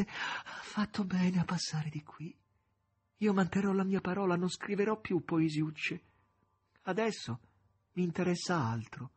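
put-on a feverish young poet making a promise; young poet Ivanushka.

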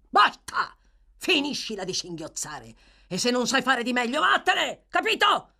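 loud, high bursts of fury; angry shouting. A narrator's reading. Brute orders the sobbing girl out, angry shouting. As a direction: explode on the short commands.

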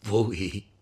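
un accenno d'ira contenuta; ira trattenuta. Acted. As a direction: scandisci con rabbia repressa. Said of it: "una voce che esprimeva un'ira". (un filo d'ira)

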